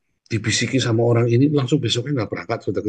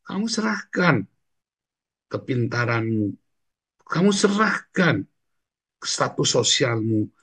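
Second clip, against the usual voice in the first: slow emphasis; 3 semitones higher, slower than usual. Acted. Slow emphatic sermon point.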